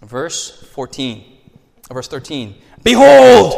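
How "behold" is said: loud, higher exclaimed behold; shouted behold. Shown as a demonstration. Pastor reading scripture, shouting behold as taught. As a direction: hit it hard and loud. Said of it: "scream it out loud".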